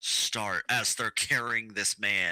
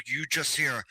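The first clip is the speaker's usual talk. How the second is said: normal talking voice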